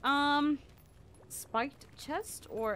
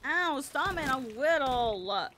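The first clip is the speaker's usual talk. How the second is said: put-on babyish whining; 4 semitones higher than usual. Little crab to attackers, babyish whine. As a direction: pout it like a picked-on little creature.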